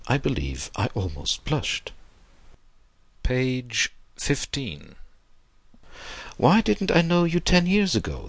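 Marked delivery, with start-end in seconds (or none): 6.4-8.3 put-on high-pitched, wistful old gentleman; old man. A narrator's reading. Old man to his new young friend, high-pitched and regretful. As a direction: plead it in an old man's raised pitch. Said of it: "the old man went on".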